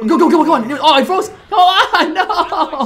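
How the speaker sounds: high loud gaming yells; excited yelling. Real, not acted. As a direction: shout at the screen in excitement. Tense moment in game.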